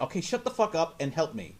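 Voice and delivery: normal talking voice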